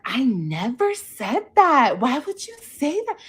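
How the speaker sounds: retold indignant protest; indignant denial. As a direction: act out a disbelieving denial, pitch climbing on the question. Acted indignant denial in retold quarrel.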